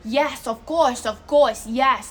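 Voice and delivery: loud, sassy kid mimicking a foreigner; mocking mimicry. Little Italian kid mimicking a tourist's English, loud and mocking. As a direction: parrot it back loudly to tease. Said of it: "like, mimicking what I just said".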